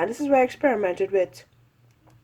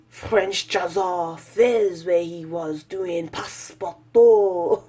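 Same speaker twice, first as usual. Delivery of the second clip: mock French accent; 4 dB louder, slower than usual. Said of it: "that's a horrible French accent".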